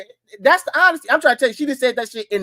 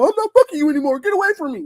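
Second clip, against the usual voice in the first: imagined upset man; 6 semitones higher, faster than usual. Retold or imitated. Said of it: "he coulda got emotional".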